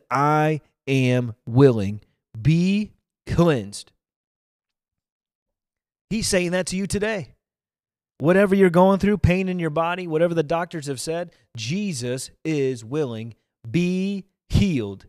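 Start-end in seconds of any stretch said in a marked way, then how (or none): 0.1-3.9 each word stretched out, "Be cleansed" set apart; solemn slow declaration. Acted. Preacher quotes Jesus to the sick, slow solemn declaration. Quoting Jesus' healing command, slowly. 11.5-15.0 slow healing declaration. Real, not acted. Preacher declares healing to viewers, slow solemn command.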